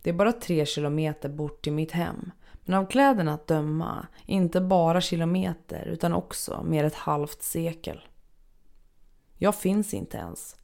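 ordinary delivery

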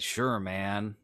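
normal talking voice

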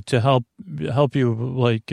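normal talking voice

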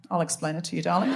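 low, soft imitation of a condescending man; mock-patronising, quiet and deep; mansplaining man. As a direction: drop low and soft, explaining to a darling. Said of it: "Man right here just went".

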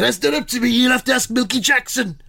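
high, plummy royal voice; King Charles impression. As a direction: pitch a TV show like the king. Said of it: "proper impression".

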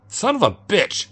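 high, loud pained cursing; angry curse. A narrator's reading. Injured bartender curses.

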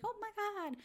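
lightly mocking Lisa in a squeaky high voice; high, breathy mock gasp. Gossiping podcaster mocks Lisa's gushing reaction, high mock gasp. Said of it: "She's like".

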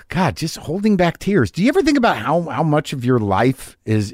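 ordinary delivery

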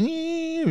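high, held siren squeal; imitated siren. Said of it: "You know that siren, like".

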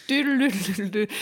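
playful alien babble; alien talk. Retold or imitated. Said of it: "teaching me how to talk like an alien".

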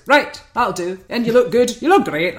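brisk imitation in a wobbly Scottish-sounding accent; Louis Walsh. Louis approves the new coach, loud attempted accent. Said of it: "I can't do the accent".

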